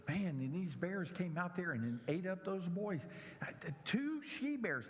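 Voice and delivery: high, lively Bible teacher voice; storytelling teacher. Retold or imitated. Bible class teacher telling the bear story, lively and high. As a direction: ham up the excited teacher. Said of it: "a Bible class teacher who was telling the story".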